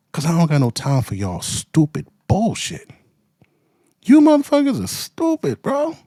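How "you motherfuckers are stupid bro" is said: high, loud furious outburst; angry shout. Real, not acted. Ranting at people he disagrees with, shouted. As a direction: blow up at them.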